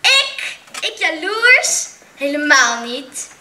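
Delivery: high, loud, slow disbelief; indignant protest. A narrator's reading. Joep protesting he is not jealous, high and indignant. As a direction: protest as if wrongly accused. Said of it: "Joep protesteerde".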